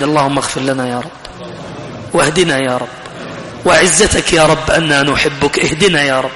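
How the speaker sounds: low, soft opening prayer; hushed, low-pitched dua; supplication. Real, not acted. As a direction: plead humbly with God, low and subdued. Closing supplication begins.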